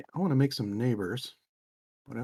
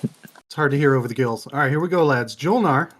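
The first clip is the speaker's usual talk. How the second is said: raised voice, cheering on the team; loud rallying call; 9 dB louder than usual. Pretend. Rallying the lads for Julnar.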